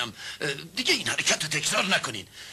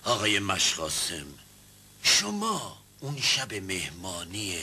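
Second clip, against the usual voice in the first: usual speaking voice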